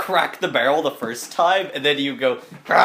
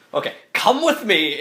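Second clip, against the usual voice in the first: bossy cook; 7 semitones higher than usual. Acted. Cook orders the character along.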